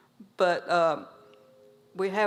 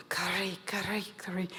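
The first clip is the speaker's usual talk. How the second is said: whisper; 6 dB quieter than usual. Acted. Little brother enjoys back scratch.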